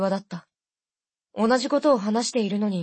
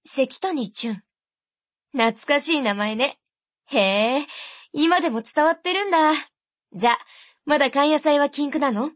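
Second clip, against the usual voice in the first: put-on higher, chatty older sister rattling on; fast-talking sister; 4 semitones higher than usual. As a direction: keep it brisk and nostalgic. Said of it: "姉貴は早口を変えない".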